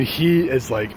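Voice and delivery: ordinary talk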